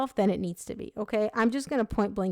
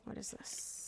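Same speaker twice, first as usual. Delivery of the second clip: whisper; 19 dB quieter, slower than usual. Real, not acted. Puzzled aside at a card.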